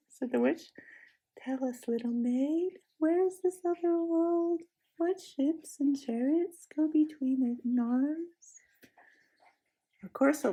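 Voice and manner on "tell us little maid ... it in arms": coaxing, mocking. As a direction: lure her gently with false interest. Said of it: "same coaxing, half-mocking tone".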